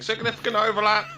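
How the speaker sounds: high-pitched sudden shout; loud, high mock call across the track. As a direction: yell it out from half a lap away. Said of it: "You'll be half a lap away shouting".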